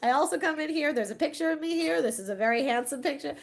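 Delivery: put-on high, loud proud old man bragging; grandpa voice. Grandfather bragging to neighbors in lobby, high proud voice. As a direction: puff up like a proud grandpa showing off.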